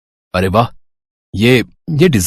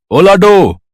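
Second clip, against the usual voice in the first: louder, higher call to Lado; 7 semitones higher, 4 dB louder, much slower than usual. Acted. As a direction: call her from the next room. Father calls daughter from afar. (बेटी को दूर से ज़ोर से पुकारते हुए)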